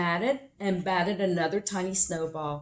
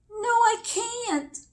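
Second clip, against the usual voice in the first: put-on high, slow little-girl voice; high and slow, a small girl protesting; slower than usual. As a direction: voice Little Nell as a tiny timid girl. Small girl's dialogue.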